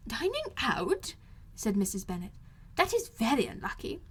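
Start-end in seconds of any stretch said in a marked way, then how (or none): 0.1-1.2 dismayed mother, drawn out high; fretful mother hearing bad news; high, slow dismayed question. A narrator's reading. Mrs Bennet voiced. 2.8-4.0 high, agitated mother voice; high fretful matron protesting; fussy Mrs Bennet. A narrator's reading.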